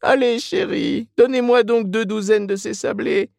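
high put-on woman's voice; coaxing Mrs Reilly. A narrator's reading. Mrs Reilly asks saleswoman for sablés, coaxing. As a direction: order cakes in a high, familiar voice.

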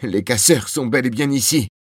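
excited announcement. A narrator's reading. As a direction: share thrilling news with restrained excitement. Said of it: "tellement excité que sa voix se brisait par moments". (annonce excitée, voix plus aiguë)

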